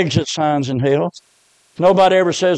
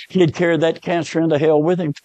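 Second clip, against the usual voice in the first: ordinary delivery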